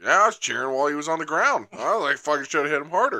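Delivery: mock bloodthirsty fan glee; gleeful cheering. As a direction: crow it like a fan cheering a dirty hit. Friend gloating about illegal hit.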